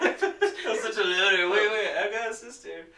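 hammed-up Natasha suddenly remembering her sister; mock brooding loner. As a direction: drag it out, then snap to surprise, hammed up. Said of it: "she's always like".

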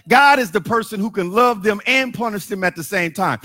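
normal talking voice

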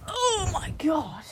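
slow high loud oh my God; breathless giddy exclaim; overwhelmed. Real, not acted. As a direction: gasp it out, giddy. Lightheaded, overwhelmed.

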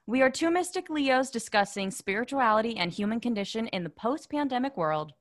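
loud, punchy show-opener read; podcast intro. Acted. Host introducing the two mystic Leos to listeners, loud announcer read. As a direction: announce the show with full projection.

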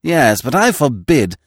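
loud, high, flustered protest; indignant objection. A narrator's reading. Magistrate trying to stop the intruding girl, indignant.

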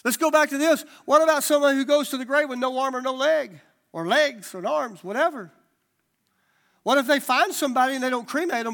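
loud, high preaching build; rising fervent sermon push. Real, not acted. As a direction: preach it out, pressing the crowd. Preaching at full force.

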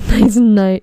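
loud laughing correction. Real, not acted. Correcting her own sign-off slip, laughing loudly. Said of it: "I was gonna say midnight".

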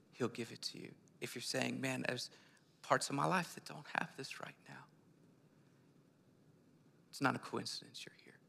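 softly whispered appeal; intimate whisper. Real, not acted. Pastor inviting listeners to receive peace, whispered. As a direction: draw them close in a whisper.

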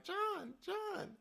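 high calling of a name. Retold or imitated. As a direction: call after him from afar. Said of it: "We were like".

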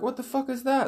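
high-pitched mock alarm; alarmed kids. Kids see the bus captured, high mock panic. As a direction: imitate startled kids, voice shooting up. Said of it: "they're like".

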